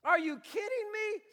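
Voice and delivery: high, loud, disbelieving rant; exasperated rant. Retold or imitated. Paul scolding the church, a loud high exasperated rant. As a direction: scold the people, punching each question. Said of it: "He's like".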